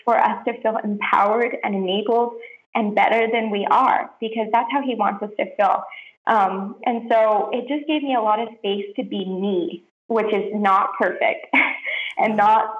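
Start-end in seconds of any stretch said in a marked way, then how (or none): none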